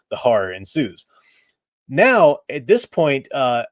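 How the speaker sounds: ordinary talk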